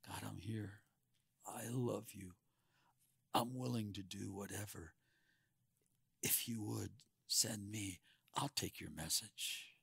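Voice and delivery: soft, near-whispered humble prayer; hushed, humble offering to God. Pretend. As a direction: speak it softly, bowed down in humility. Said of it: "his attitude is more like".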